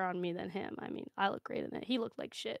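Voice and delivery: whispered smug aside. Real, not acted. Player brags about the stolen costume in a smug whisper.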